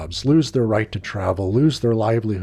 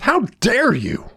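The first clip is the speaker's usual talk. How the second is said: slow, high-pitched indignation; affronted teacher drawing out each word; outraged shout; 11 semitones higher than usual. A narrator's reading. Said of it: "shouted Mister Smith".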